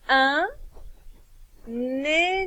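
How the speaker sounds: spaced, carefully articulated dictation word; teacher dictating a word to pupils; slow dictation. Shown as a demonstration. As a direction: dictate "nénuphar" slowly for children to write. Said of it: "Premier mot à écrire".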